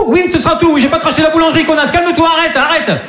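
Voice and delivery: high, fed-up snapping; mock exasperated reply. Customer snapping at the baker, hammed-up and pitched high. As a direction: pretend to lose it at the baker.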